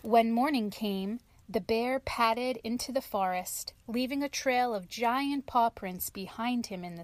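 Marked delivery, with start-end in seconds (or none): none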